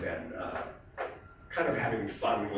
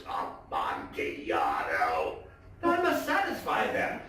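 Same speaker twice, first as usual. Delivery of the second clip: eager Fortunato; 6 semitones lower, slower than usual. Acted.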